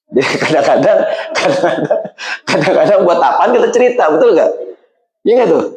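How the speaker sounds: half-laughing rueful remark. Real, not acted. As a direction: laugh through the words. Laughs at the situation.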